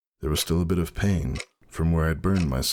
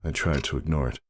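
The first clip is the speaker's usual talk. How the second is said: ordinary delivery, nothing marked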